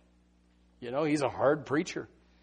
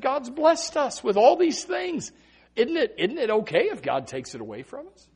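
Job to his wife; 11 semitones higher, 7 dB louder than usual. Acted.

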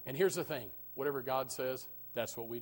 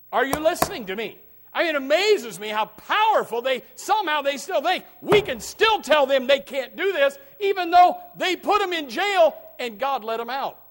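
louder, higher shouting preacher; fiery preaching; 7 semitones higher, 6 dB louder than usual. Acted. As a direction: shout it out to wake up the church. Preaching at full force.

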